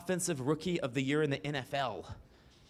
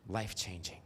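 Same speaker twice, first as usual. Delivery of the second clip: hushed aside; 6 semitones lower, 8 dB quieter than usual. Real, not acted.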